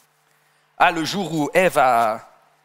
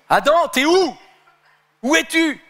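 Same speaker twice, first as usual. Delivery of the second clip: much higher, louder call of God; 9 semitones higher, 5 dB louder than usual. Acted. God calling Adam in the garden, loud and high. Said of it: "Dieu lui dit".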